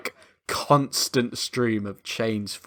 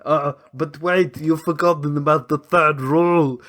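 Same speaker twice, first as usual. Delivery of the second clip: smug Arnie; 3 semitones higher than usual. Retold or imitated. Arnie slyly cueing his brother about the third rule. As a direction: draw it out like a sly hint. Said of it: "he's like".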